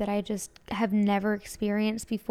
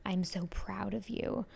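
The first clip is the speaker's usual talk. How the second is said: whispered quote; 8 dB quieter than usual. Retold or imitated. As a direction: breathe it like a loving secret. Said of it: "but it was like".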